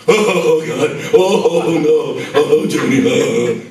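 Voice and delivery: mock weeping. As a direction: imitate a soppy weeping lyricist.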